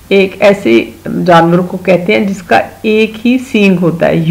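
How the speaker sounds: ordinary talk, nothing marked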